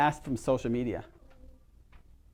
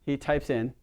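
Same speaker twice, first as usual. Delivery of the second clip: usual speaking voice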